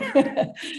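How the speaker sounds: a breathy, moved little laugh. Real, not acted. Host laughs, moved by the ride for her friend. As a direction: laugh it off while close to tears.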